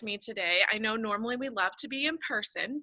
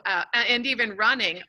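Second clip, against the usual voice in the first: ordinary delivery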